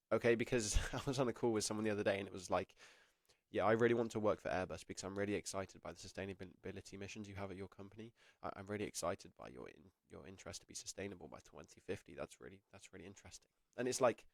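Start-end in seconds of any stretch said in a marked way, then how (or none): 3.5-13.5 imitating a flat, low-energy answer that fades much softer. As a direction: recite it like you don't believe a word. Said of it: "no one believed you when you said that".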